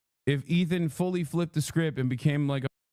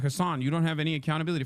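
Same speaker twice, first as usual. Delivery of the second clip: rattled-off mock; much faster than usual. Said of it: "these dumbasses will be like".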